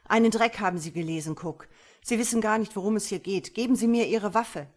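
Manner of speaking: loud, heated rebuke; scold, then order him to hand it over; angry command. A narrator's reading. Officer angrily demands the sergeant's weapon.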